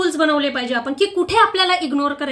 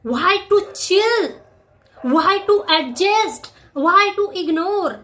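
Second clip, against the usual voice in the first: slow rhetorical questions; 3 semitones higher, much slower than usual. Real, not acted.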